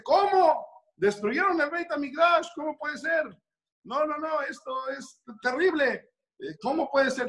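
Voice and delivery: the villager hears the Temple fell, aghast; shocked disbelief. Retold or imitated. Se lleva las manos a la cabeza. (incredulidad escandalizada, voz mucho más aguda)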